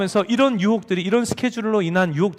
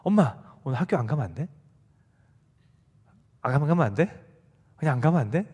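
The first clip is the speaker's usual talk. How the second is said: put-on lower, softer whiny pleading child; 3 semitones lower, 3 dB quieter than usual. Child begging mom to skip school, soft whiny plea. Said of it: "막 이렇게 조르는 거예요".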